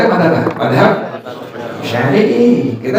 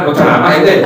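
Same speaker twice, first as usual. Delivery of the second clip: normal talking voice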